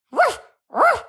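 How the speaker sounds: high, yappy little woof woof; happy puppy bark. Acted. Puppy tickled by his new family, yapping high with joy. Dog barks happily when tickled.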